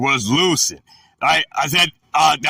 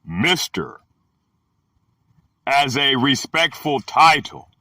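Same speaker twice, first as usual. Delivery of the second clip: slow, spaced reading with long pauses; dictionary read-out; slower than usual. Acted. Teacher reading the Greek word's meanings, measured read-out. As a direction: read each entry separately and deliberately.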